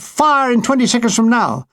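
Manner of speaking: high, half-shouted fire order; mock shout. Pilot shouting fire orders from the plane, mildly shouted. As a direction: call the order from above. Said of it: "He would shout".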